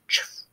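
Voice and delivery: whispered shoe scuff on the path; soft whispered chuff. Acted. Father's shoes on the shady path, whispered chuff. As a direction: breathe it out like a soft footstep. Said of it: "They make a soft sound now".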